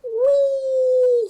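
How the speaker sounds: very high, loud whee; gleefully diving home. Acted. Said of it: "said Rosie".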